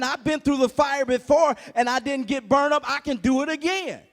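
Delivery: loud, rising sermon; preaching at full force. Acted. Preacher rouses congregation, shouting with rising fervor. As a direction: drive it home to the congregation.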